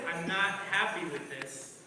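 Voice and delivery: low, soft, solemn God voice; deep voice of God. Acted. God speaking quietly to children, low and grave.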